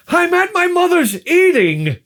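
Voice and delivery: very high, loud nagging-wife caricature; mock wife screech. Wife answering husband's call, hammed-up screech. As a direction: squawk it like a cartoon wife.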